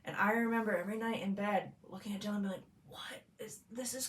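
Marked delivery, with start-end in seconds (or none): 2.9-4.1 whispered mock bewilderment; bedtime whisper. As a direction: whisper it in retold disbelief. Whispering to partner in bed, retold.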